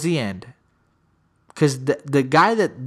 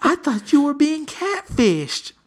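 high, slow, disbelieving cry; shocked son; 10 semitones higher, slower than usual. Retold or imitated. Son reacting to his mom catfishing, high and outraged. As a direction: squeal it out in outrage.

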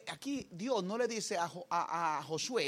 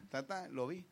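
ordinary talk

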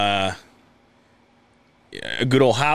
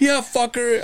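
high put-on retort; brash high caricature; Howard; 11 semitones higher, slower than usual. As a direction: fire back in a pitched-up mock voice. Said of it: "that's how Howard talks".

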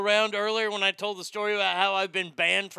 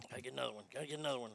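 muttering; 9 semitones lower, 19 dB quieter, much faster than usual. Real, not acted. Searching for dropped pen.

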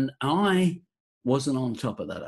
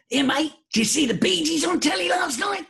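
excited workmate. Retold or imitated. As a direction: play the eager factory mate. Workmate excitedly asking about Bee Gees.

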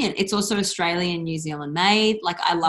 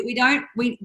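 ordinary talk

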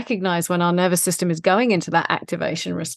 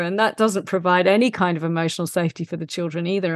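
ordinary delivery

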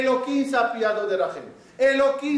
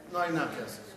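low, soft "No hay nada que hacer"; resigned voice; 8 semitones lower, 9 dB quieter, much faster than usual. Retold or imitated. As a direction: drop the voice low, giving up. Divine attribute voiced.

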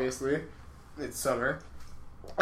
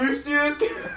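laughing disbelief; 10 semitones higher than usual. Retold or imitated.

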